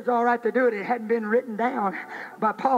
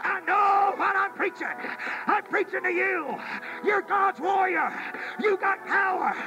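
high, driving revival preacher delivery; impassioned sermon cry, each phrase thrown out high; full-force preaching; 7 semitones higher than usual. Real, not acted.

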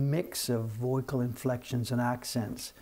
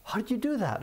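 much higher astonished question; mock amazed colleague; 9 semitones higher than usual. Staff member asking the owner how he guessed, high amazed. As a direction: ask it in playful amazement. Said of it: "he's like".

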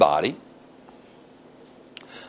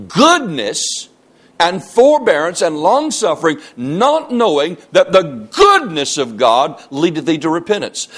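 raised, emphatic pulpit reading; forceful scripture reading; 5 semitones higher, 5 dB louder than usual. Real, not acted. Preacher reading Paul's warning to the congregation, forceful scripture reading. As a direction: proclaim the verse, punching the key words.

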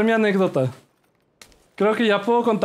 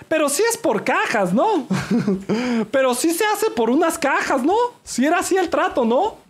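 raised, playful questioning; high teasing voice; 6 semitones higher than usual. Real, not acted. Streamer teasing chat about the deal, playful high voice.

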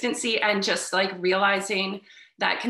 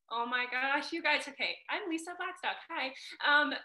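emotional, higher, soft and cracking; wavering cracky voice; 6 semitones higher, 5 dB quieter than usual. Real, not acted. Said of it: "my voice is, like, wavering and cracky".

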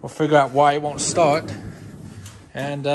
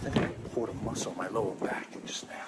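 whisper; 8 semitones lower, 13 dB quieter, much faster than usual. Real, not acted. Whispered aside about sore back.

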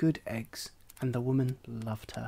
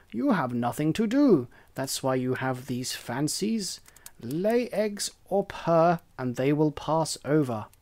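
louder, preachy hen voice; scolding hen; 4 dB louder than usual. Acted. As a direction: lecture him like a bossy hen.

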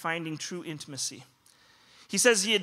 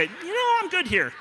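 mock lazy doctor; 11 semitones higher, 4 dB louder than usual. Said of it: "get to a certain point and say".

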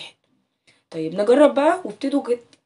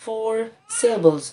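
four syllables said slowly with a firm stress on syllables; drawn-out classroom conclusion; slow emphasis; 4 dB louder, much slower than usual. Shown as a demonstration. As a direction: stress the answer slowly for the kids. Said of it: "ها؟".

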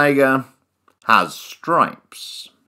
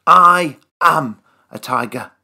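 plain reading voice

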